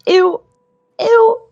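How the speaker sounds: high, squeamish little "ew"; grossed-out character. Acted. Crimson realizing she is stepping on flesh, grossed out. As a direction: squeal each "ew" high in disgust. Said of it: "she's gonna be like".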